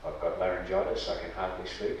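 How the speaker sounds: soft, subdued voice as if unable to project; hushed, low-effort delivery; very quiet talk. Shown as a demonstration. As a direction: barely push the voice, demonstrating a mic. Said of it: "talk at the same level".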